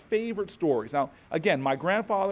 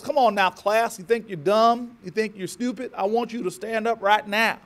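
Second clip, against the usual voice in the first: higher-pitched, insistent Southern schoolteacher; Southern accent; 5 semitones higher, 4 dB louder than usual. Retold or imitated. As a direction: challenge the class in a Southern teacher's voice. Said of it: "She goes".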